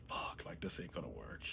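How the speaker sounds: breathy unvoiced whisper, half to himself; whispered worry. Whispering to himself, worried about his leg brace. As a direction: whisper the doubt like nobody should hear.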